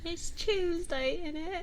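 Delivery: high, drawn-out mock British accent. Streamer practising for the DM, mock British accent. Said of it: "really good accent from some".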